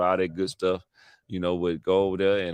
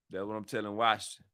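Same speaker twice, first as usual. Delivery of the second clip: ordinary delivery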